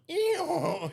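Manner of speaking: high, drawn-out donkey hee-haw; one long high-pitched mock bray; donkey's laughing bray. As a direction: imitate a donkey cracking up. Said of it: "he's just laughing his head off".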